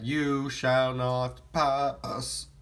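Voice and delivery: very slow, drawn-out wizard command; Gandalf impression. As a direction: stretch every word like the wizard on the bridge. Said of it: "this line said by Gandalf".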